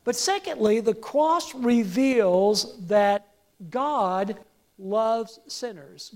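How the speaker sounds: usual speaking voice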